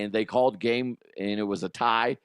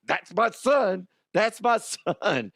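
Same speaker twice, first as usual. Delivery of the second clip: proud dad, voice cracking; 8 semitones higher than usual. Retold or imitated. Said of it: "my voice was cracking all over the place".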